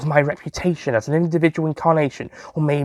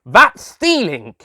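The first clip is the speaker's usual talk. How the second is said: loud slow accusing cry; shouting; 6 semitones higher, 5 dB louder, much slower than usual. Acted. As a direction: shout it after her. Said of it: "I shouted".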